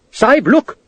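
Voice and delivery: high, urgent cry; urgent alarm. A narrator's reading. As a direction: point and call out. Urgent pointing call.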